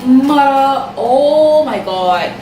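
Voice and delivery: ordinary talk, nothing marked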